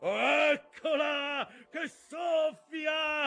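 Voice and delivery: high chanted cry, vowels stretched long; slow, drawling litany. A narrator's reading. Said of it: "gridò in una lenta, strascicata, metodica litania".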